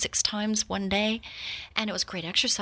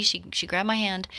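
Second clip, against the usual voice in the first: ordinary talk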